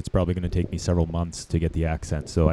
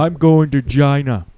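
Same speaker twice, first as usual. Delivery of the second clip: loud, higher Trump boast, slow; Donald Trump impression; 5 semitones higher, 7 dB louder, slower than usual. Comic doing Trump's famous line, loud and slow. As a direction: puff up and declare it like Trump. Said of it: "I know he says China".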